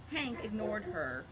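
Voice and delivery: usual speaking voice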